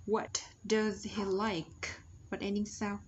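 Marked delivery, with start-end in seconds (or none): 0.1-1.9 slow, separated "What does he like?"; slow model question. Shown as a demonstration. Teacher drilling the pattern for learners, slow careful model.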